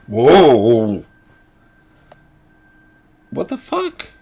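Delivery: loud, high whoa then what the fuck; incredulous outburst. Real, not acted. Reacting to the lawyer's habeas corpus claim, incredulous. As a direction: blurt it out in disbelief.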